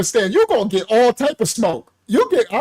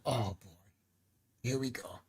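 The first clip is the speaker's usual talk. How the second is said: weary aside; 11 semitones lower, 18 dB quieter than usual. Retold or imitated. Dreading what's coming.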